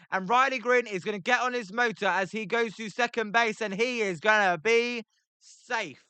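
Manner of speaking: rising, quickening call of a double; excited play-by-play. Acted. Calling the double live for viewers, excited play-by-play. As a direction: build the call as the ball hits the wall.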